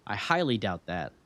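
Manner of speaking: ordinary delivery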